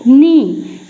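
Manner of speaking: नी said as a clean nee with no k sound; silent k knee. Shown as a demonstration. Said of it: "क्या पढ़ेंगे".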